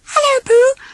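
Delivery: very high, tiny squeaky voice; a little piglet greeting his friend. Acted. Piglet greeting Pooh, tiny and very high.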